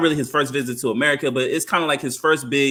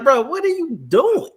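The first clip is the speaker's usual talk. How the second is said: high-pitched, mock-baffled challenge; mock incredulity; 11 semitones higher, 4 dB louder than usual. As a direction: call them out in acted disbelief. Acted reaction to a fake accent.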